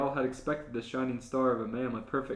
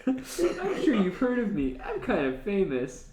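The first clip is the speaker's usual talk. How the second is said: put-on higher, louder, giggling self-satisfied girl; laughing Misa voice; 8 semitones higher, 5 dB louder, slower than usual. Misa boasting to L about her fame, giggly. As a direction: boast sweetly through giggles.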